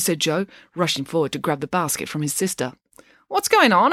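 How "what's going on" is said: suspicious. Acted. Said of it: "asked Finn suspiciously".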